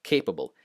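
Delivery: CAY-pa-ble, falling away after the start of Capable; stress on first syllable. Shown as a demonstration. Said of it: "not capable".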